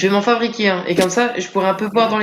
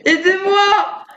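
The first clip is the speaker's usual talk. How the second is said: hammed-up, much higher, slow shriek for help; mock cry for help; 14 semitones higher, much slower than usual. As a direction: squeal for help like a panicked cartoon victim. Skeleton attacks in the dark.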